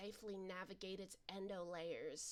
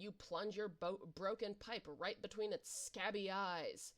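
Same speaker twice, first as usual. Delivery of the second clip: normal talking voice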